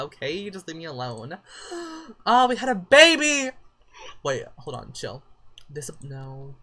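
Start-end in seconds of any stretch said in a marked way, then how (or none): 1.4-2.2 high, quick gasp; sharp gasp. Real, not acted. Reacting to the baby's birth. 2.2-3.5 high, loud, thrilled squeal of news; excited cry. Real, not acted. Excited at the baby's birth.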